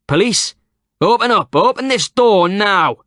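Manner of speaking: high, loud slow commanding shout; police shout. A narrator's reading. Police officer outside ordering the door open, shouting. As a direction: bellow it through the door.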